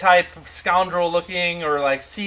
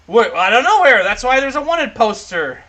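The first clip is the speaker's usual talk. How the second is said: loud, higher exasperated dwarf; 7 semitones higher, 7 dB louder than usual. Acted. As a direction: answer the demand loudly in a raised pitch. Dwarf answers the angry demand.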